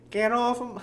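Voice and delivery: high-pitched old person attempt. Shown as a demonstration. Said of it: "how do I do an old person voice?".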